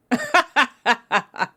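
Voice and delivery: loud, mocking vampire laugh; gleeful laugh. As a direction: laugh down at your helpless prey.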